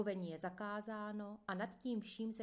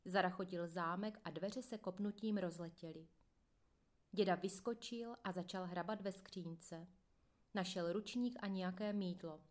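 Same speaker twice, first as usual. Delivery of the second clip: ordinary delivery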